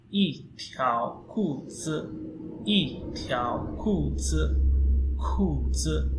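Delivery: 一条裤子 with a falling yì, drawn out; Mandarin demo. Shown as a demonstration. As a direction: drill "一条裤子", 一 as fourth tone.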